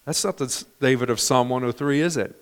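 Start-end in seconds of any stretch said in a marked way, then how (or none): none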